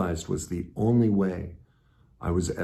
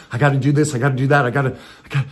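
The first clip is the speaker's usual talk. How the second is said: driven, louder and higher pressing; frantic urgency; 7 semitones higher, 9 dB louder, much faster than usual. Retold or imitated. His old self pushing himself, frantic and driven. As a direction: rattle off the musts in a frenzy. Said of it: "I was always like".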